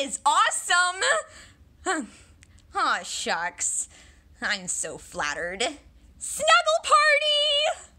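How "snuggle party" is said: excited cheer. Acted.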